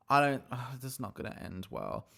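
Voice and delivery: ordinary talk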